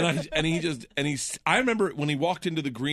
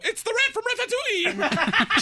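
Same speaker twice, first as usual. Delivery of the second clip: high squealing kid impression; excited kid voice; 4 dB louder, slower than usual. Kids meeting Patton Oswalt, voiced in a high squealing kid voice. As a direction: voice his thrilled children.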